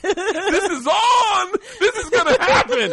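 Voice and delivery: very high, loud, stretched-out excitement; hammed-up shrieking glee at a coming fight; wildly excited. Imitating Puerto Rican husband thrilled by a confrontation, wildly excited. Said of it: "he got so excited".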